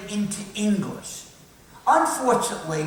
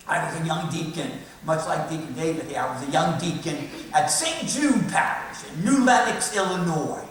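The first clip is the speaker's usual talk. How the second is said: usual speaking voice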